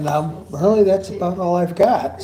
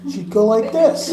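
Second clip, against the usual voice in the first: normal talking voice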